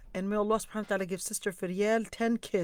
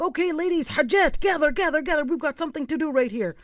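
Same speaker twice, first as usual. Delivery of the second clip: raised, high rallying call; 7 semitones higher, 5 dB louder than usual. Retold or imitated. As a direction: summon a group of ladies to gather. Calling women to gather round.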